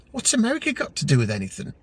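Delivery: high, fast puzzled question; perplexed. A narrator's reading. Puzzled friend questions the mention of America, perplexed. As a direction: ask it in quick bafflement.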